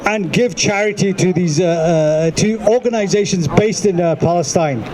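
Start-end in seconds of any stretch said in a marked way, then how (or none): none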